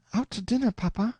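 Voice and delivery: put-on light, high young woman's voice; young daughter voice. A narrator's reading. Daughter answering her father on her way out, lightly girlish.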